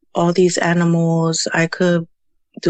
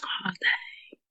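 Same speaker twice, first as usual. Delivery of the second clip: whisper; 14 dB quieter than usual. Real, not acted. Surprised by how long it's been.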